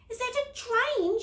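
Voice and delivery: high, loud surprised yelp. A narrator's reading. Girl yelps at the shiny train in the tunnel. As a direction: yelp in shock at the sight. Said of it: "she yelped".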